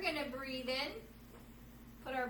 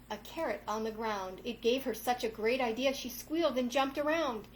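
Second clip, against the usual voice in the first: story narration; 4 dB louder than usual. A narrator's reading. Yoga teacher reading a picture book to kids, storytelling voice. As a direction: read the rhyming tale to the kids.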